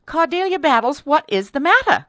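high, loud demand for an explanation; alarmed cry. A narrator's reading. Mistress to her speechless maid, crying out in alarm. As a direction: cry out sharply at the maid, pitched well up. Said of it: "cried Missus Townsend".

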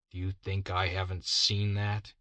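plain reading voice

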